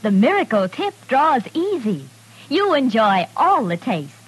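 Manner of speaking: normal talking voice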